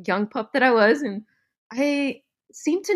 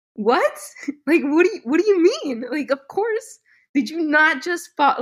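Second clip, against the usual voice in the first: higher-pitched, mock-incredulous questioning; retold incredulity; 7 semitones higher than usual. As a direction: act out his baffled disbelief. Husband's incredulous reply, acted.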